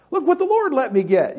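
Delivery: high-pitched, delighted boast; proud excitement. Retold or imitated. Preacher recalling showing off his truck, proudly excited.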